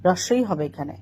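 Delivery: ordinary delivery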